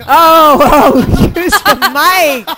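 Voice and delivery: laughing through the words, slow. Real, not acted. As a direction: crack up while saying it. Laughing at the mic joke.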